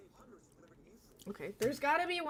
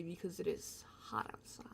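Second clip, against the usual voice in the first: soft unvoiced whisper; 3 semitones lower, 11 dB quieter than usual. Shown as a demonstration. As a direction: whisper the weather line. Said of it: "I sound like a weather reporter".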